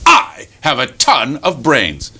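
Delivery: slow, drawn-out brag; boastful fox. Acted. Fox brags to the rabbit, pompous and slow. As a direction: stretch I and TON grandly. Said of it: "And fox looks over".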